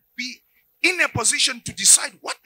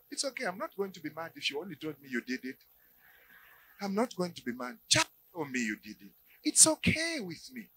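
soft, quiet coaxing voice, rather fast; coaxing sweetly; 4 semitones lower, 9 dB quieter than usual. Acted. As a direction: lure the confession gently. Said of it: "changes the tactic".

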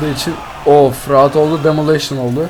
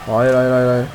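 ordinary talk

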